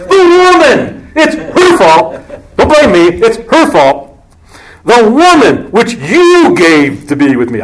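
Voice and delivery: high, slow whining excuse; whiny blame. Retold or imitated. Man blaming the woman to God, high slow whine. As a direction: point the finger, pleading. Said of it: "a typical answer by man".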